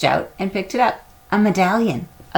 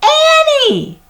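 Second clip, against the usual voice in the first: very high, loud frightened call; alarmed shout; 8 dB louder than usual. A narrator's reading. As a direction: scream her name in panic. Jack hears Annie shriek.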